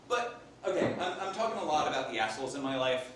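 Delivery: normal talking voice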